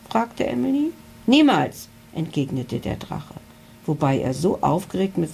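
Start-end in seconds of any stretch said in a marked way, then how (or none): 1.2-1.9 loud, higher refusal; defiant never. A narrator's reading. The dragon refuses to go home, loud and defiant. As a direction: spit it out defiantly.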